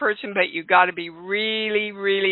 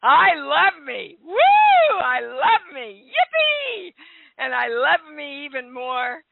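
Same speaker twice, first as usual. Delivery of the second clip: high, jubilant cheer with drawn-out whoops; exuberant, high-pitched declaration of self-love; with conviction; 7 semitones higher, 3 dB louder, slower than usual. Pretend. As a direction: shout out the affirmation like a celebration. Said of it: "say it with conviction".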